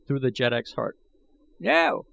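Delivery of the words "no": put-on high sharp old man's cry. A narrator's reading. Old man crying out to stop Gahan's sword, high. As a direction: stop him in his tracks. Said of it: "cried I Gos".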